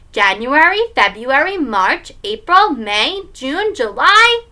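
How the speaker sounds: put-on child's singsong recitation, rising to the end; reciting. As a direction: recite months like a proud little kid. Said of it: "Fudge recited".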